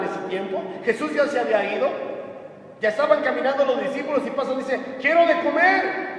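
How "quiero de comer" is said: high, slow call for food; acted beggar crying out; demanding beggar's call. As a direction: call out hungrily to passers-by. Acting the hungry beggar calling out.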